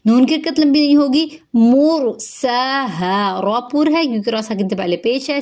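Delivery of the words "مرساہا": مرساہا with a heavy ra and the sa stretched; tajweed recitation. Shown as a demonstration. Teacher reciting the word, very slow. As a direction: stretch the sa in مرساہا by one alif. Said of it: "سین کے اوپر کھڑا زبر ہے، ایک الف کے برابر لمبا ہوگا".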